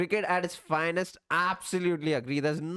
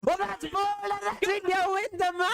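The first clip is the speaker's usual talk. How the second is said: excited; 12 semitones higher than usual. Real, not acted. Match-winning ball, live reaction.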